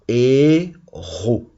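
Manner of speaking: "Héros" in two long, separate syllables; sounding out. Shown as a demonstration. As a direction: draw out "Héros" as hé... ros. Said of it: "on entend presque tout".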